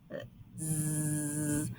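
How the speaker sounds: a long soft buzzing zzz sound. Shown as a demonstration. Teacher demonstrating the voiced S to pupils, soft buzz. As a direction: hum out a steady zzz. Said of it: "you should hear or feel".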